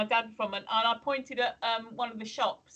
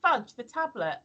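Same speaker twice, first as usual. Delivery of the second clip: retold question; 6 semitones higher than usual. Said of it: "I said".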